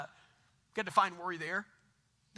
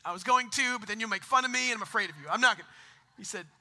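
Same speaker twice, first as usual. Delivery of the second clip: ordinary talk, nothing marked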